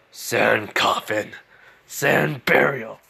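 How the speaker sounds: very slow, drawn-out attack name; menacing attack call. Acted. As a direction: stretch each word out with deliberate menace. Gaara calls his deadly attack.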